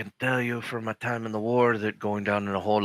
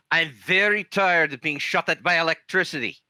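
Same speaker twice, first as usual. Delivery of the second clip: higher, exasperated complaint; fed up; 8 semitones higher, 4 dB louder than usual. Acted. Player's character is tired of being shot at, exasperated. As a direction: play weary exasperation with a raised voice.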